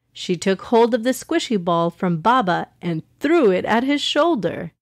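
usual speaking voice

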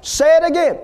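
high, loud, punched demand; authoritative command. Retold or imitated. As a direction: fire her up to repeat it. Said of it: "Say it with authority".

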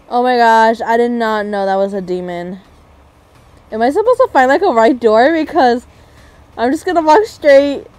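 loud, rushed panic; panicked. Real, not acted. Fleeing a demon, loud frightened talk. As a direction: shout in real fright while running.

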